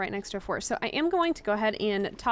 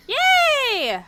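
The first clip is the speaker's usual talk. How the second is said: high, loud, drawn-out celebration; relieved cheer; 14 semitones higher, 9 dB louder than usual. Real, not acted. Gamer celebrating the danger being over, loud relieved cheer.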